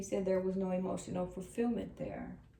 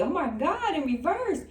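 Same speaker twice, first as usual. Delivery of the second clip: much higher and louder, thrilled by the card; excited exclamation; 9 semitones higher, 8 dB louder than usual. Real, not acted. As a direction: blurt it out in delight. Reader reacts to the card drawn.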